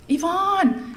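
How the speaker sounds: high, glad call of a name; girl greeting her friend, high and glad; excited call. Retold or imitated. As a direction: call out across the room, delighted. Veronica running over calling.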